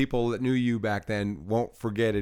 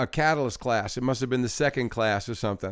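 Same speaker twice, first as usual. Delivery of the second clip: ordinary talk, nothing marked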